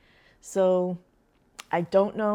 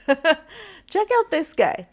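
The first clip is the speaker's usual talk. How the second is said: amused laugh; 9 semitones higher, 5 dB louder, much slower than usual. Real, not acted. Laughing at a video clip.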